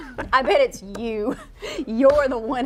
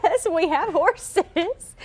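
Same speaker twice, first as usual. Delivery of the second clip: higher, drawled Southern reply; Southern accent; 9 semitones higher, 4 dB louder, much slower than usual. Retold or imitated. Answering little boy's cowboy question, drawn-out Southern accent. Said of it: "I don't know if it was my southern accent".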